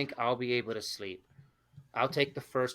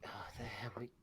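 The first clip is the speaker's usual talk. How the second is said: whispered awe; 16 dB quieter, faster than usual. Real, not acted. Awed muttering aside.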